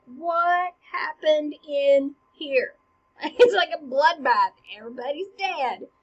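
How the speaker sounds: loud, slow, shocked voice that laughs at the carnage; shocked Fortinbras. Acted. Fortinbras breaking into the castle, aghast. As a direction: burst in and take in the slaughter.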